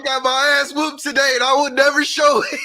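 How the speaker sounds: laughing through the words, high. Real, not acted. Man laughing while telling his friend about a rough day, laughing. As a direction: giggle while getting it out. Retelling coming home beaten, laughing.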